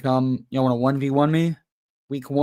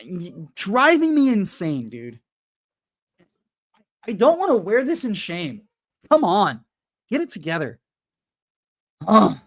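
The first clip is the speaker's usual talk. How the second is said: exasperated; 5 semitones higher than usual. Real, not acted. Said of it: "rant over".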